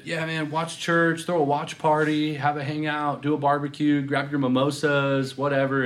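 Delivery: mock a breezy church promo pitch; casual promo pastor.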